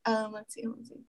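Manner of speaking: whispered, murmuring to herself; whispering. Real, not acted. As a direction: whisper while reading ahead. Reading to herself.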